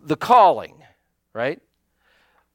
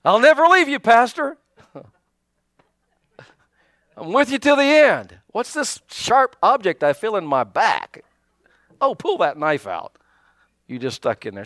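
mock false promise; 3 semitones higher than usual. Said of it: "You can say anything".

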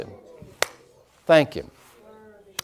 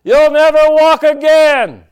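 very loud, high taunting shout; booming accusing voice; 10 dB louder, slower than usual. Retold or imitated. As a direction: belt it out as the menacing inner voice. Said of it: "I would hear this voice".

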